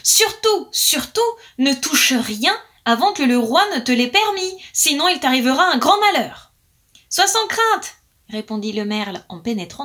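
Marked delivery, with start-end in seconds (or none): 0.0-6.5 high urgent warning call; calling after him. Acted. Magpie calls a loud warning after the departing blackbird. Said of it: "La pie lui cria encore". 7.1-8.0 high, light blackbird reply; high bird voice. Acted. Blackbird calls back to the magpie, high and carefree.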